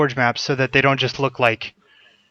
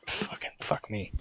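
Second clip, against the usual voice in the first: frustrated whispered swearing; whispered curse; 2 semitones lower, 16 dB quieter than usual. Real, not acted. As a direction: hiss the curse under your breath. Frustrated that audio is still bad.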